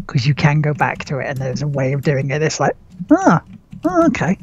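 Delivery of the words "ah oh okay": high sudden ah oh okay; surprised realization. Retold or imitated. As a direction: act out the penny dropping. Mock realization quote.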